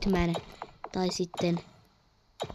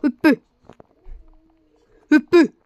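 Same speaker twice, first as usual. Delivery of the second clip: shouted command; 6 semitones higher, 8 dB louder than usual. Real, not acted. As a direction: yell Hyppy! high and loud. Shouting jump in game. (huutaen korkealta)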